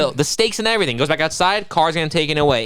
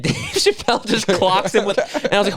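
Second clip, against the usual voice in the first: usual speaking voice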